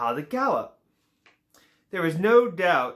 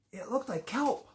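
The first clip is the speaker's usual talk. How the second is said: hushed wonder; 5 semitones higher, 8 dB quieter than usual. A narrator's reading. Narrator hushed at the discovery.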